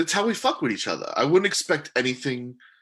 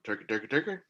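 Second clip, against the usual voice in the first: mimicking an echo, each repeat softer; echo imitation; 3 semitones lower, 8 dB quieter, faster than usual. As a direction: echo the word back, fading out. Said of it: "It was echoing".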